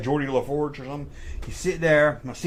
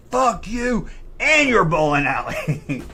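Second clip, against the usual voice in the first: loud, high, drawn-out mock scream; retold scream; 6 semitones higher, 4 dB louder, slower than usual. Angry customer yelling at the bowling alley, re-enacted scream. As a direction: re-enact screaming it, stretched out slowly. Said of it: "I screamed it loud".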